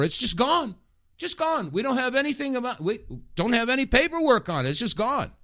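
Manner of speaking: pitched high in disbelief; incredulous. Real, not acted. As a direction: say it in shocked disbelief. Outraged at missing money.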